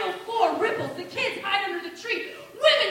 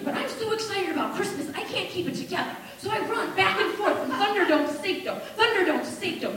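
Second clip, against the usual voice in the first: ordinary delivery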